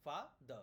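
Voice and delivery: father broken into "fa, the"; "fa, the" with a gap between the syllables; split into syllables. Shown as a demonstration. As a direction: say "fa, the" as two separate syllables. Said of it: "there are two syllables in father".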